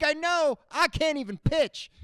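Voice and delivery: high, loud put-on voice; a hammed-up lament; mock whiny reply. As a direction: whine it back at them. His own mocking reply.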